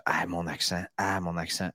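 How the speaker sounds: low, soft self-conscious muttering; retold self-consciousness. Host replays his worry about his accent, self-conscious. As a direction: imitate yourself fretting, voice dropped. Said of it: "Puis à un moment donné, je dis".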